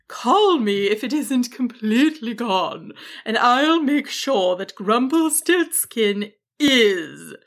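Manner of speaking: slow dragon voice with understated menace; ominous. A narrator's reading. Dragon promises to deal with the wizard, ominous. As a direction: draw out the end as a veiled threat. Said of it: "said with an ominous look".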